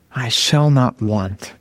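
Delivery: low, slow, savoring scripture reading; really slow reading. Shown as a demonstration. Preacher demonstrating meditative psalm reading, really slow reading. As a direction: linger over each word of the verse. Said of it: "really slowly".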